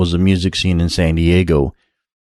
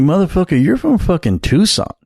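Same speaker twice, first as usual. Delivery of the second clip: pitched way up in mock disbelief; scoffing at the Tucson band, much higher; incredulous retort; 10 semitones higher than usual. Calling out the band from Tucson, incredulous and higher. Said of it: "And I'm like, I'm like".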